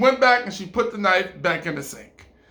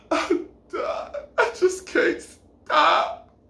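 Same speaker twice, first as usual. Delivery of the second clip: high, slow, hammed-up crying; sob, then wail loudly on long words; mock wailing; 12 semitones higher, 5 dB louder, much slower than usual. Woman confesses she can't stop, theatrically wailing.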